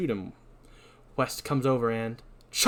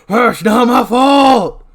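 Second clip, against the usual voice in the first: slow, very loud, high bellow; furious shouted denial; 10 semitones higher, 15 dB louder, much slower than usual. Acted. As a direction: roar it while swinging a shovel. Swinging shovel in acted quarrel.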